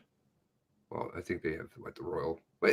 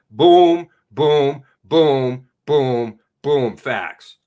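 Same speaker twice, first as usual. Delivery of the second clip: punchy boom; 6 semitones lower, 5 dB louder, much slower than usual. Retold or imitated. Mimicking rapid-fire facts.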